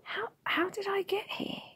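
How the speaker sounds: put-on high, whispery, faltering child; stammering. A narrator's reading. Confused boy asking how he got here, stammering. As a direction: stumble on the first word, small and whispery. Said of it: "stammered Will".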